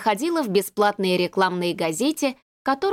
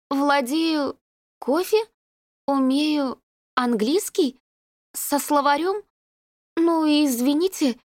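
put-on young secretary on the phone; 6 semitones higher than usual. A narrator's reading. Lyudochka phoning firms.

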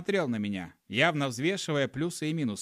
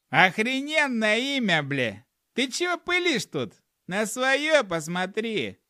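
high, raised-voice gnome retort; loud, pitched-up scolding; gnome snapping back; 8 semitones higher, 4 dB louder than usual. A narrator's reading. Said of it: "прикрикнул гном".

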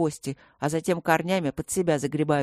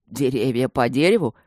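low, louder, doubting chuckle in the voice; incredulous smirk; 5 semitones lower, 4 dB louder than usual. A narrator's reading. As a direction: scoff in disbelief. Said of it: "усмехнулся дракон недоверчиво".

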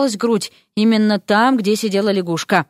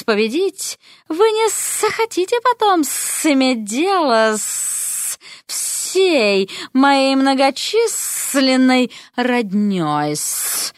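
slow, high, sly snake-woman drawl with hissed endings; hissing snake lady; 4 semitones higher, slower than usual. Acted. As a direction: purr it out like a scheming serpent. Serpentina threatens Eric.

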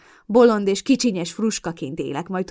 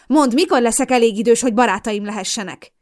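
loud, higher, bitter question; bitter angry demand; 4 semitones higher, 8 dB louder than usual. Acted. Daughter hurls a bitter question at her mother, loud. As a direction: throw the question in her face.

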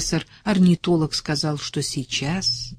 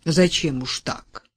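ordinary delivery

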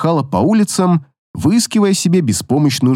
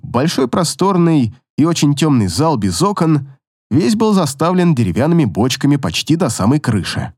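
plain reading voice

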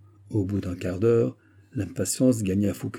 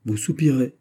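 ordinary delivery

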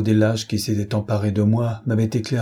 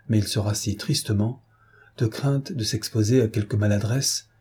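ordinary delivery, nothing marked

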